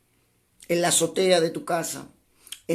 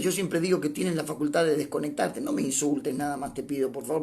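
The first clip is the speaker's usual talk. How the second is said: ordinary talk, nothing marked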